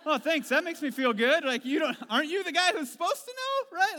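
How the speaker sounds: sarcastic retort. Retold or imitated. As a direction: drip sarcasm at the clueless pilot. Sarcastic reply to the pilot.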